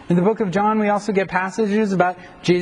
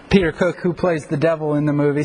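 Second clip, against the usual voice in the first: ordinary talk, nothing marked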